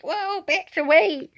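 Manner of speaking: high, drawn-out mock call to a dog; startled calling out. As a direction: call the dog back in alarm. Holding back the dog on leash.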